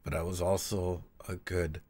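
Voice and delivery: slow reading voice that sinks to a hush by the end; slow, hushed storybook reading; story reading. Acted. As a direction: read it gently and let it fade nearly to a whisper. Reading the story passage aloud.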